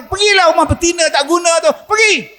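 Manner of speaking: fast, high chasing-off; angry wife. Acted. Wife sending husband to the other woman, fast and high. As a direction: drive him out, quick and high. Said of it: "saya marah dia".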